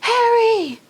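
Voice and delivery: high calls from far off; distant calling. A narrator's reading. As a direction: call the name from somewhere distant. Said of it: "other voices crying".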